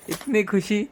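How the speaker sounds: high, soft giggling; sweet laugh. Real, not acted. Daughter giggling sweetly while dad films. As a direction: giggle sweetly through the words. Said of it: "एवढं गोड कोण हसतं".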